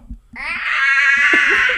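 shrill cawing like a crow, loud; loud bird caw. Shown as a demonstration. Friend asked on the podcast to caw, a loud crow caw. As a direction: caw at the birds outside. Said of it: "you wanna give us a caw?".

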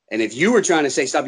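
ordinary talk

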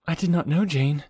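constrained voice. A narrator's reading. Hazel to her friend after the swoon, mildly constrained. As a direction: hold back, speak with slight constraint. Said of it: "said Hazel in a constrained voice".

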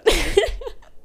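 high giggle; a bright little giggle; giggling. Real, not acted. Giggling at own joke.

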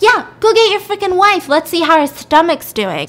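high, loud indignant dare; acted angry challenge. Woman daring husband to fetch his wife, loud challenge.

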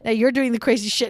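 ordinary delivery

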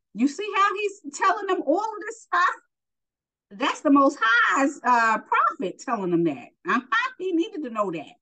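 high-pitched, excited commentary; excited aside. Real, not acted. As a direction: marvel at it, voice leaping high. Marvelling aside on the scripture.

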